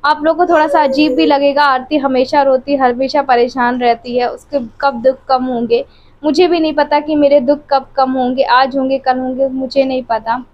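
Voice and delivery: ordinary talk, nothing marked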